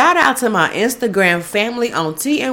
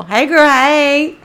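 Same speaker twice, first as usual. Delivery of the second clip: excited shout-out; 4 semitones higher, 6 dB louder than usual. Real, not acted. Host greets DJ [name], excited and loud. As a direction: squeal a greeting to a friend.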